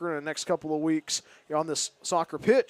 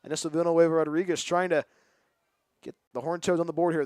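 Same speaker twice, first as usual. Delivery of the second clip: ordinary talk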